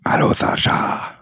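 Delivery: slow breathy whisper with no voice. Pretend. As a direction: whisper the greeting slowly. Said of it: "Warum flüsterst du?".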